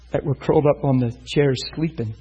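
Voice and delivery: normal talking voice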